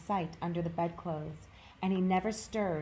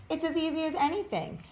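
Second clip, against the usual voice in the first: boastful furry rabbit; 10 semitones higher than usual. A narrator's reading. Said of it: "said the furry rabbit".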